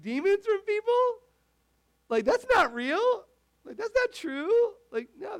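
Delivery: high, scoffing disbelief; mocking skeptic. Pastor voicing doubters of Jesus, high and scoffing. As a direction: scoff at healing and deliverance as movie stuff.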